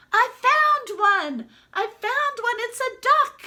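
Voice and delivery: put-on high, loud excited boy; boy shouting his find, high and loud. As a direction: call it out across the beach.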